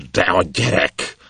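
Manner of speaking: slow, breathless pant; captain panting in rage; panted words. Acted. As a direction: gasp it out through clenched fists. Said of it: "lihegte ökölbe szorított kézzel".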